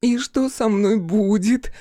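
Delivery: high, slow whiny plea; whining. Acted. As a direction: whine it like a scared child. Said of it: "заныла Фиона".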